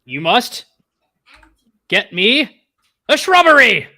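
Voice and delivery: rising, loud, halting pronouncement; mock dramatic demand. Hooded stranger demands shrubbery, mock-dramatic shout. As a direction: build word group by group to a high shout.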